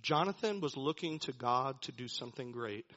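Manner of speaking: ordinary talk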